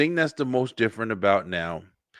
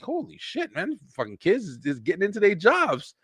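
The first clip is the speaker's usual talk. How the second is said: amazed; 9 semitones higher than usual. Retold or imitated. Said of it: "I'm like".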